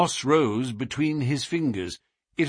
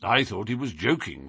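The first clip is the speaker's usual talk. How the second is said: ordinary delivery, nothing marked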